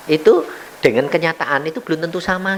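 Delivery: ordinary talk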